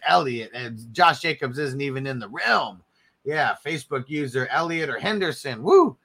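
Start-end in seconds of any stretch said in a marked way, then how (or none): 5.6-6.0 a sudden high, loud whoop of joy; excited whoop. Real, not acted. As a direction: let out a big happy cheer. Cheering a pick on stream.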